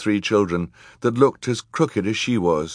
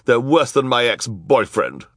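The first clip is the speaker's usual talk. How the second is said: higher, scornful society woman; snappish; 5 semitones higher, 4 dB louder than usual. A narrator's reading. Esme complaining about the children, snappish higher voice. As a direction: snap the complaint in character. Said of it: "Esme snapped".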